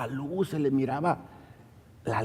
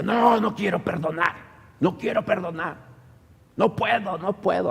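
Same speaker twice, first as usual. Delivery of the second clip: imitating a changed, higher-pitched voice; possessed refusal; 6 semitones higher than usual. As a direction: act out a manifesting spirit's refusal, pitched up. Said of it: "Hasta la voz le cambió". (voz cambiada y aguda, negándose a perdonar)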